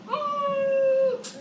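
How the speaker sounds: high held mm; a long doubtful high hum; petty grammar side kicking in; skeptical hum. Real, not acted. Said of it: "petty grammatical side kicking in".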